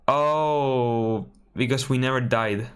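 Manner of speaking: normal talking voice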